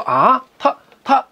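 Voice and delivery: startled "ah", then halting words; shocked stammer. A narrator's reading. Guo Jing hearing his sworn brother jumped overboard, shocked stammer. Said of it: "郭靖大吃一惊".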